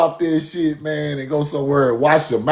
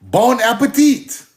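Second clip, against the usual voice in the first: mock French; 4 semitones higher, slower than usual. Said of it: "talking about some bon appetit".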